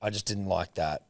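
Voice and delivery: soft, low private aside; hushed, low-pressure acted plea; discreet request. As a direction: ask a mate quietly for a favour.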